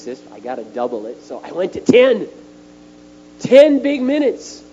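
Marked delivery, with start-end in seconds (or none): 3.4-4.6 loud, high and slow on each word; big emphasis. Real, not acted. Boasting to the congregation about ten minutes, loud and slow. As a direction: stretch and boom every word proudly.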